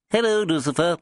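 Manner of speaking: high, bright little man's trill; trilled greeting. A narrator's reading. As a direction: trill it like a jolly little boss. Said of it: "trilled the little fellow".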